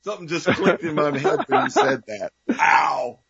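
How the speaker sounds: high-pitched, stunned wow; floored and amazed, voice shooting up; real astonishment. Real, not acted. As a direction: react as if it has just clicked. Host amazed by guest's revelation.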